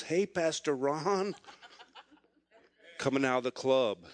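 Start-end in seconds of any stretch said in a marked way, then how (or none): 0.0-1.3 slow, drawn-out, higher-pitched hail; stretched-out mock greeting; lightly voiced tipsy greeting. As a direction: imitate a tipsy younger guy calling out. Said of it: "kinda stumbling a little bit".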